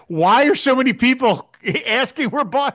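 half laughing in disbelief. Real, not acted. Laughing at the Boston joke.

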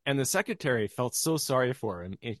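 ordinary delivery

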